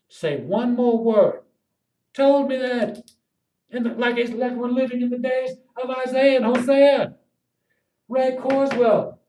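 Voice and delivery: indignant outburst. Retold or imitated. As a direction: voice climbs and swells, stretching the threat out.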